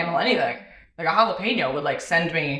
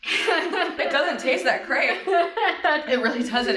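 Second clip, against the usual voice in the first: laughing; 7 semitones higher, much slower than usual. Real, not acted. Laughing through hot sauce burn.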